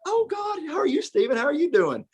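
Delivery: very high, gushing mock welcome; delighted woman's greeting, imitated. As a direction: imitate a hostess thrilled by an unexpected visitor. Voicing friend's relative greeting him.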